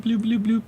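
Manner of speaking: high, bouncy bloops; cartoon bubble-pop sounds; playful bloop noises. Shown as a demonstration. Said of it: "if I do this little bloop".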